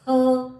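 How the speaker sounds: her said as a lone, punched 'huh'; isolated h sound. Shown as a demonstration. Teacher giving learners the letter's sound, isolated h. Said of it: "और ह इसका sound है".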